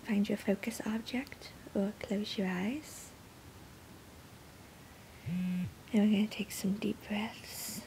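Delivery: soft, settling meditation voice; guided meditation. Real, not acted. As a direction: gently ease listeners into stillness. Begins leading guided meditation.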